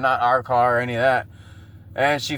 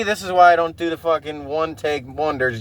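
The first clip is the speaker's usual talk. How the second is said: ordinary delivery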